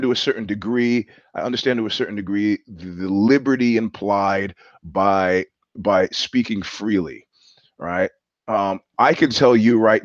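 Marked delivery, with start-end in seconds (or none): none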